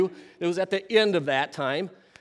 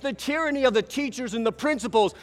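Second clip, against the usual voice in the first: higher and louder rousing call to arms; hammed-up hero rousing the class; movie rally speech; 7 semitones higher, 6 dB louder than usual. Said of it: "this speech that you give, like you see in the movies".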